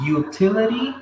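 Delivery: utility drawn out high and slow; slow pronunciation model. Shown as a demonstration. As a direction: lift and stretch utility for the class. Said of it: "Pronúncia, mais uma vez".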